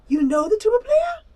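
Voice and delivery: squealing delight; thrilled little creature; excited high voice. Acted.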